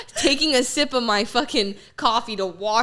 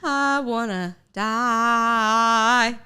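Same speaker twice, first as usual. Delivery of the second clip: slow, deadpan; much slower than usual. Retold or imitated. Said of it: "I'll be like".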